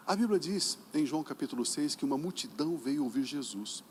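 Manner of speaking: ordinary talk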